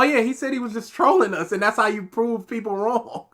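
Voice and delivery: normal talking voice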